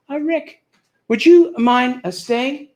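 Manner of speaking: high, halting, coy request; lightly voiced lady star with loaded pauses; Ginger Rogers. Star asking the comedian to stay behind, high and hesitant. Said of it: "Ginger turned to me and said".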